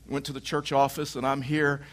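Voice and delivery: ordinary delivery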